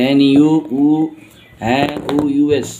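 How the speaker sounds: ordinary talk, nothing marked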